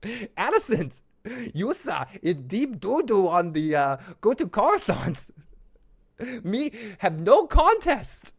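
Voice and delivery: squeaky goofy Gungan scolding; Jar Jar Binks impression. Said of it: "I just hear Jaja Binks's voice".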